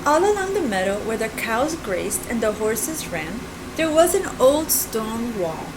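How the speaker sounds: put-on gentle read-aloud for kids; storybook narration. A narrator's reading. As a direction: read it like a picture book.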